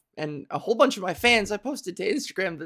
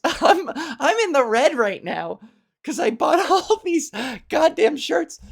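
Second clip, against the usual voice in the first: laughing; 7 semitones higher, 4 dB louder than usual. Real, not acted. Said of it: "laughs through confession".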